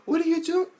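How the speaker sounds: high startled mock cry; imitated ghost wife. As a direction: squeak it out like a spooked ghost. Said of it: "the ghost, like".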